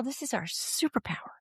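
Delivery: whispered aside to a friend. Real, not acted. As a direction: lean in and whisper it like a secret. Confiding aside to co-host.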